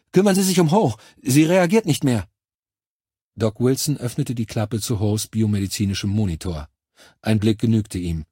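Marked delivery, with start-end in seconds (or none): 0.1-2.3 hoch und laut, atemlos dringlich; aufgeregte Meldung. Acted. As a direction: melde den Notfall in Panik. Soldat meldet Notfall um Ho. (Soldat meldet dem Doc aufgeregt, hoch und laut, den Notfall)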